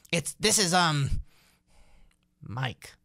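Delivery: halting, with a long pause before the name; hesitant. Retold or imitated. Driver fumbling for his own name on the phone, hesitant. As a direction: stall as if trying to remember your name. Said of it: "him thinking, "What's his name?".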